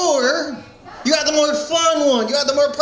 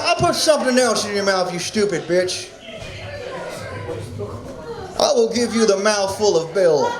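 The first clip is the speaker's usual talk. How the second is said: Bill Clinton impression; 4 semitones lower than usual. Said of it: "the worst Bill Clinton impression".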